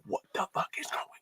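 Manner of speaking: fast, breathless whispered panic; panicked whisper. Retold or imitated. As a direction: hiss it out in a rush, scared. Panicked aside at the protest.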